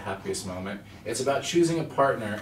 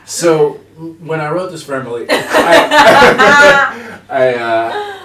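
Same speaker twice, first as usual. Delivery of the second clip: laughing; 5 semitones higher, 16 dB louder, much slower than usual. Real, not acted. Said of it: "laughing through the words".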